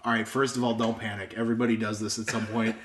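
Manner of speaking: low, soft grandpa voice; imitating a soothing grandfather; calm reassurance. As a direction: quietly settle a panicking child. Grandpa reassures panicking child.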